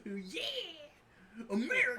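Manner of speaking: high loud patriotic cry; Uncle Sam voice. Acted. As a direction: cheer it like a cartoon patriot.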